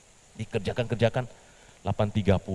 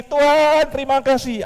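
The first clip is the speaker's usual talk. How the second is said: much higher, louder giddy waving thanks; 11 dB louder than usual. Acted. Petrus soaked again but still thanking God, giddy. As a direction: sing out thanks to everyone.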